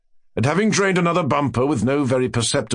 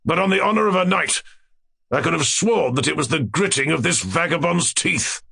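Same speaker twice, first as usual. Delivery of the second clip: high-pitched, blustering royal voice; tyrant king; 6 semitones higher than usual. Acted. King accusing the vagabond dwarf, blustering. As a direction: insist on it with kingly bluster.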